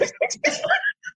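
very high squealing laugh; shrieking laugh; big laugh. Real, not acted. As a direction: crack up high. Laughing at texting story.

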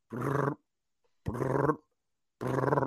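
soft lip buzz like a vibrating phone; phone buzz imitation. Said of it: "I felt my phone in my pocket".

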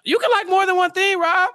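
animated protest. Real, not acted. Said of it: "teasing Rob, big pitch jump".

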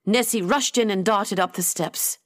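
plain reading voice

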